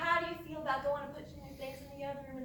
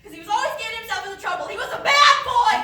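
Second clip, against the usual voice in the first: words tumbling out faster, building to a shriek; rising manic shout; 8 semitones higher, 18 dB louder than usual. Acted. Mother at dinner rants about her dead son, shrieking. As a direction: rant faster and faster until screaming.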